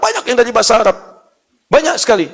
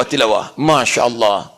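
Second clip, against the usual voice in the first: ordinary talk, nothing marked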